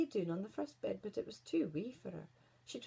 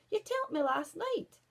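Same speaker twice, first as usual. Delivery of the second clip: high, louder protest; indignant shout; 9 semitones higher, 5 dB louder than usual. Acted. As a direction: accuse the mirror, outraged. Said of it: "shouted the Queen".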